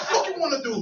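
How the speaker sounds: high-pitched, hammed-up challenge; retold angry dare; mock angry challenge. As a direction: act angry and square up. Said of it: "Like, angry as shit".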